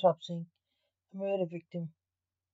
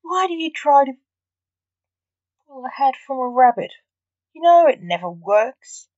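Blake scolding; 6 semitones higher, 4 dB louder than usual. Acted. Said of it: "Blake said".

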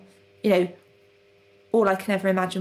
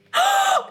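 high surprised gasp; 5 dB louder than usual. Real, not acted. Sees the other pot.

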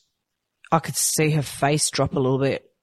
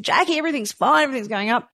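bright, much higher, fast reassurance; cheery nurse voice; 8 semitones higher, much faster than usual. Retold or imitated. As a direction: chirp it like an upbeat nurse.

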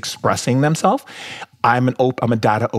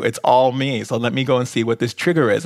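ordinary talk, nothing marked

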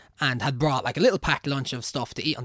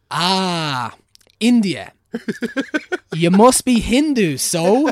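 loud, high, slow patronising priest voice; hammed-up pompous priest, high and loud; mock priest; 7 semitones higher, 10 dB louder, much slower than usual. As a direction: lean into the priest's smug certainty. Priest voiced declaring India.

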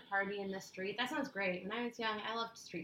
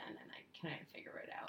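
whispering; 4 semitones lower, 11 dB quieter than usual. Real, not acted. Whispered trailing.

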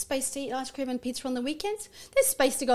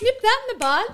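mock exasperated mum; 10 semitones higher, 8 dB louder than usual. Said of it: "I'm like".

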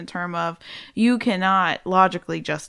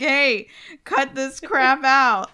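slow, stern, louder scold; 6 semitones higher, 4 dB louder, much slower than usual. Retold or imitated. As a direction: tell it off slowly. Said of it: "just be like".

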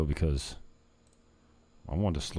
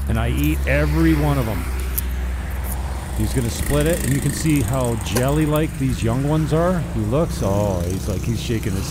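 excited; 5 semitones higher, 6 dB louder than usual. Real, not acted. Excited commentary, coconut splitting.

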